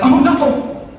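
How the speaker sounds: a panicky father clutching his pearls; high mock-shocked worried father; horrified dad.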